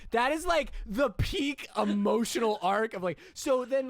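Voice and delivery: loud laughing rant; excited shout. Real, not acted. Laughing rant about the movie.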